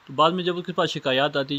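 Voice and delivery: usual speaking voice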